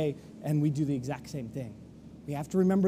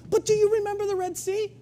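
much higher, urgent reminder of the Red Sea; eager reminding; 11 semitones higher, faster than usual. Retold or imitated. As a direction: fire them up with memories, quickening. Joshua rallying the crowd.

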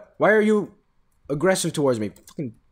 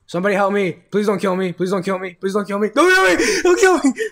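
mock pleading; 3 dB louder than usual. Player begging a mob not to kill him, hammed-up panic.

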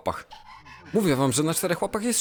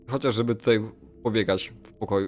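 ordinary talk

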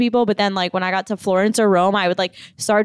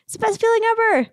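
much higher, fast and thrilled; 13 semitones higher, much faster than usual. Retold or imitated. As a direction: gush with sudden delight. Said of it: "And I'm like".

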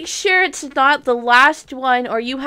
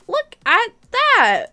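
higher and slow, savoring it; delighted high drawn-out exclaim; 10 semitones higher, 4 dB louder, slower than usual. Real, not acted. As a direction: fan thrilled by new players, a slow high squeal. Showing new server players.

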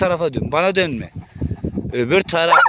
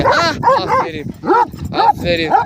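high praise. Real, not acted. Praising snake.

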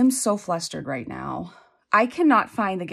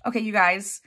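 normal talking voice

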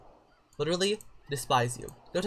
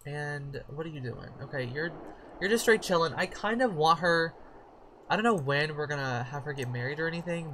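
ordinary talk

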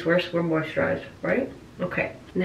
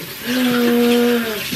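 long, loud, horrified gasp; panicked gasp; 5 dB louder than usual. Real, not acted. Prankster seeing the stains, panicked gasp. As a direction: suck in air loudly in panic.

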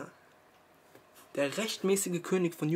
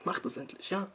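hushed urgent whisper; 2 semitones higher, 8 dB quieter than usual. Real, not acted. Vlogger urging viewers to finally understand, whispering. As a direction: breathe it out as an insistent secret.

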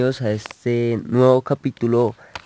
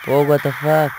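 louder, higher startled what the fuck; startled exclamation; 4 semitones higher, 6 dB louder, slower than usual. Real, not acted. Gamer startled by an enemy, higher and louder. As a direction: jolt in real shock. Sudden game surprise.

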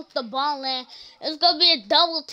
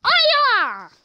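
much higher, loud mock martial-arts cry; imitating a game girl's karate shout; karate yell; 11 semitones higher, 7 dB louder than usual. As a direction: shout it like a kid throwing a punch. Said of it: "she go like this".

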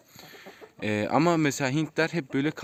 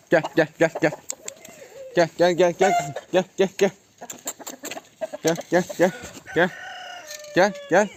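calling chickens; 5 semitones higher than usual. Real, not acted. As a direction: sing out to the chickens to come. Calling the chickens over to him.

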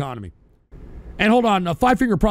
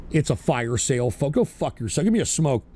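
ordinary talk, nothing marked

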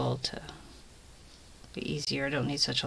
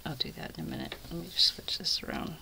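whispering; 7 dB quieter, faster than usual. Real, not acted. Whispers while knitting.

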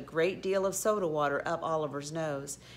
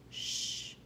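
soft unvoiced shush; hushed "shh"; 8 dB quieter than usual. A narrator's reading. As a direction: hush them quickly. Tom hushes the giggler.

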